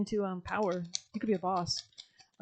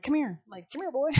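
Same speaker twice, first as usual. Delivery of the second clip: higher, coaxing call, as if to a dog; calling like a dog owner; 6 semitones higher, 4 dB louder than usual. Pretend. Spirit guides to the viewer, coaxing higher call. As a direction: beckon them over like calling a pet.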